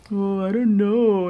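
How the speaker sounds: slow, unsure mumbling excuse; mock hesitant dodge. Mocking a voter who dodges, slow and unsure. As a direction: drag it out like a dodging voter. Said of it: "Because I don't wanna say".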